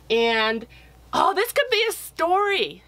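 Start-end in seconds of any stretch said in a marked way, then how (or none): 1.2-2.8 high surprised realization; as if an idea just struck; sudden delight. Real, not acted. Sudden idea while drawing.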